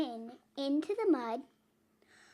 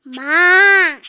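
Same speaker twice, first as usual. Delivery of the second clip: loud, higher bleating ma; goat bleat; 4 semitones higher, 16 dB louder than usual. A narrator's reading. Goat calls out to Blue, loud bleat. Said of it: "said a goat".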